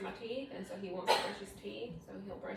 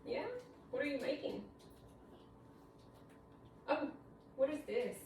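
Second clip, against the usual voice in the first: a touch of higher sing-song questions; 5 semitones higher than usual. Real, not acted. Mother talks to playing toddler.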